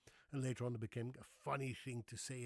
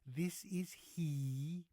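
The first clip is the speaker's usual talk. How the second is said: slow, stretched formal answer with a rising end; formal phone answer; 4 semitones higher, much slower than usual. Shown as a demonstration. Husband demonstrates the correct formal way to answer a call, slow. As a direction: say it stiffly correct, drawn out. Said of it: "You say".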